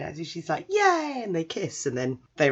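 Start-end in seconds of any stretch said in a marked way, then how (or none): 0.7-1.2 high-pitched little "yay"; acted gleeful cheer; childlike delight. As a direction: squeal happily like a small child. Little boy delighted at proposal.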